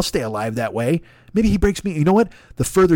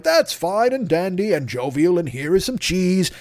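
high, slow, sing-song Dolittle voice; hammed-up gentle doctor crooning to animals; 4 semitones higher, slower than usual. Dolittle greeting animals, slow high crooning. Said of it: "And Doolittle's like".

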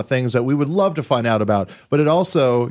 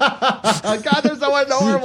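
cooing; 13 semitones higher, slower than usual. Real, not acted. Cooing over sugar gliders.

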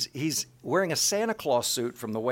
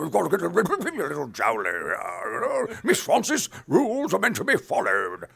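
high, loud, jowly officer; pompous old colonel lecturing; stuffy British colonel; 9 semitones higher, 8 dB louder than usual. Retold or imitated. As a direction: scold Miss Francis primly. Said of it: "British colonel from World War Two".

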